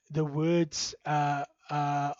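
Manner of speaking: usual speaking voice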